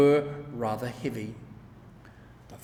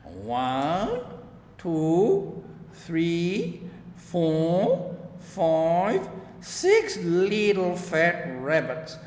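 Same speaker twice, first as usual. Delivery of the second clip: put-on gleeful old farmer counting, rising to a high drawn-out six; sly old gardener gloating over his catch; Mr McGregor counting; 3 semitones higher, 3 dB louder, much slower than usual. A narrator's reading. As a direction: count them up with a chuckle in the voice. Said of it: "repeated Mister McGregor".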